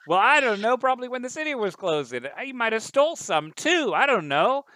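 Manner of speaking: high, loud flustered rant; shrill upset woman. Acted. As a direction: babble like a rattled witness. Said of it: "she, he says".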